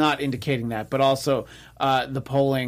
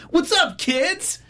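high, drawn-out put-on greeting; mock someone trying to be cool for kids; slow, high-pitched hammed-up youth-pandering voice; hey-kids mock greeting; 3 dB louder, much slower than usual. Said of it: "immediately turned into the".

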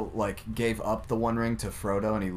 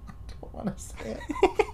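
giggling; 5 dB quieter than usual. Real, not acted. Embarrassed giggle before joke.

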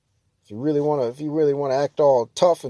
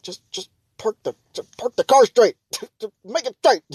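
exasperated plea; 7 semitones higher than usual. Retold or imitated. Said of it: "I'm like".

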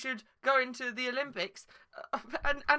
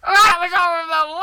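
loud, high wailing sob; pretend crying; 7 semitones higher, 13 dB louder than usual. Hurt victim bawling after being insulted by Feature Creature. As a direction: wail it like a child in tears. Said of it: "they're both crying".

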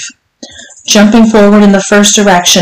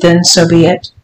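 ordinary delivery, nothing marked

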